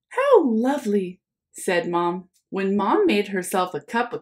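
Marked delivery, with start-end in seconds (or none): 0.1-1.2 Mom's voice. Acted. Mom answers Oliver in story.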